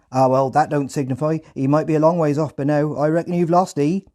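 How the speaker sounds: high, loud Hampshire farmer voice; loud rural Hampshire accent. Retold or imitated. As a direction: shrug it off like a Hampshire farmhand. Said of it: "in a thick Hampshire accent".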